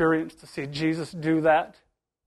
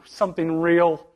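ordinary talk

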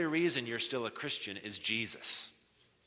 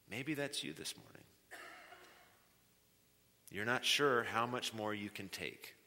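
soft consolation; 6 dB quieter than usual. Real, not acted. Pastor gently comforting a hurting congregation, soft and tender. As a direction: comfort them quietly, almost confiding.

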